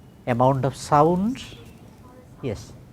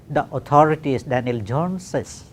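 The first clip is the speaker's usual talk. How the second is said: usual speaking voice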